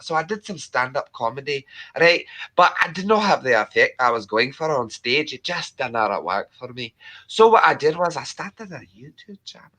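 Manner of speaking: chatty storytelling in a put-on Scottish accent. Streamer telling Thomas about stand-up, Scottish accent. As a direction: tell your story as a Scot. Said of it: "your Scottish accent".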